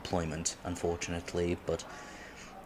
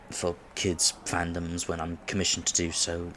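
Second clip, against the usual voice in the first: ordinary talk, nothing marked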